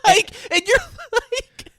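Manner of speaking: high laughing, near tears; squealing laugh-cry. Real, not acted. Friend cracking up while recapping the movie, high squealing laugh. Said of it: "laughing imitation".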